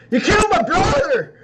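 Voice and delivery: furious shout at the killer; angry shout. Acted. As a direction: yell it, grief turned to rage. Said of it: "straightens his top hat and goes".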